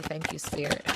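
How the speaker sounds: barely voiced thank-you under the breath; hushed thanks. Real, not acted.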